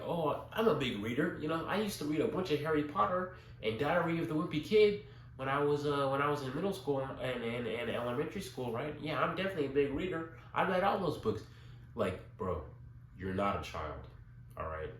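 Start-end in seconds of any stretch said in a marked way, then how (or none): 0.0-11.4 high, mocking know-it-all voice; mocking braggart. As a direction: mimic a smug self-proclaimed reader. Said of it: "you might think, like".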